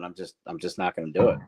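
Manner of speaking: ordinary talk, nothing marked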